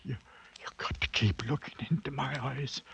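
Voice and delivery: fast desperate whisper; hushed frantic plea; urgent whisper. Acted. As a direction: beg him to hold your gaze.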